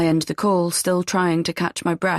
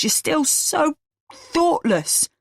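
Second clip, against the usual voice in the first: slow scornful reproach; 8 semitones higher, much slower than usual. Acted. Uncle scolding Eve.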